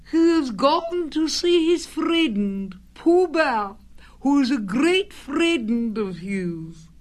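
slow, very high put-on voice; disguised Rabbit voice. A narrator's reading. Rabbit claiming he has gone visiting, disguised high voice. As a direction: recite the excuse high and drawn out. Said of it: "in a different sort of voice this time".

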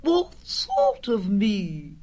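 high, slow put-on query; disguised Rabbit voice. A narrator's reading. Rabbit querying Pooh at the door, disguised high voice. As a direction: question it slowly in the fake voice.

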